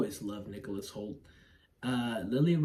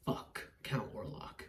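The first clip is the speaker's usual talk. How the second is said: whispering; 4 semitones lower, 9 dB quieter, slower than usual. Retold or imitated. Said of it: "but she's like".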